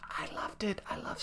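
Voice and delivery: fond whisper. Real, not acted. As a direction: confide it in a hushed breath. Recommending the game.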